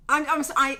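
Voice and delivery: ordinary talk, nothing marked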